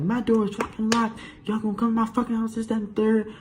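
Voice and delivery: high-pitched mock angry mom; indignant rant in a put-on high voice; cursing someone out. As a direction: imitate an outraged mother telling someone off. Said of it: "now she cursing out the cops".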